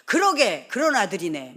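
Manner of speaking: loud, teasing agreement with a son; playful agreement. Retold or imitated. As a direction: humor him with a loud "그러게". Mother humoring son's boast. (장난스럽게 크게 맞장구)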